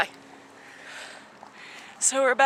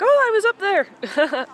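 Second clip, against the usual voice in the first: surprised; 9 semitones higher, 5 dB louder, slower than usual. Retold or imitated. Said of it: "I'm like".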